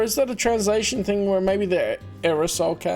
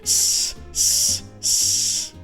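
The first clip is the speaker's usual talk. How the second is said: unvoiced snake hiss; 12 semitones lower, much slower than usual. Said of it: "doing a snake voice".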